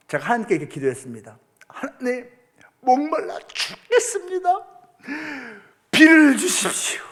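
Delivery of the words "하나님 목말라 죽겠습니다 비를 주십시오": high, pleading cry to God; hammed-up thirsty plea; mock desperate prayer. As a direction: beg heaven for rain, half-joking. Preacher retells his desperate prayer.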